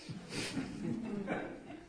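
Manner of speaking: high breathy chuckle; quick hushed laugh; airy laugh. Real, not acted. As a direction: laugh softly at the indulgence. Laughing at second helpings.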